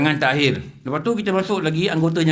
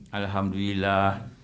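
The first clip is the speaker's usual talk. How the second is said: calm gratitude; 8 semitones lower, 7 dB quieter, slower than usual. Acted. Calm thanks despite angry boss.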